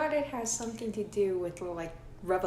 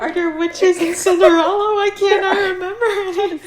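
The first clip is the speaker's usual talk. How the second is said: laughing confusion; 12 semitones higher, 17 dB louder than usual. Retold or imitated. Retells her own confused thought.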